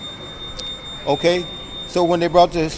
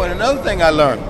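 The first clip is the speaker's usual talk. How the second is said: ordinary delivery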